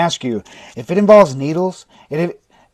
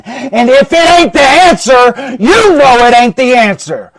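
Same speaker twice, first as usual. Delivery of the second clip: higher, louder self-scolding rant; shouting at himself; 6 semitones higher, 9 dB louder than usual. Retold or imitated. Coach yelling at himself about his coaching calls, loud. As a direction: berate yourself like a drill coach. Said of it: "And I'm like".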